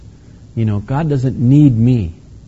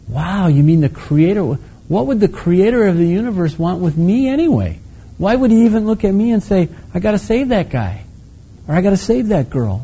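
awed wonder; 7 semitones higher than usual. Retold or imitated. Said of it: "we're gonna go".

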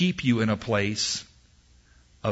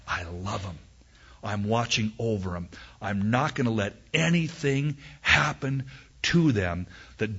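tender; 3 semitones lower than usual. Acted. God's tender words to his people.

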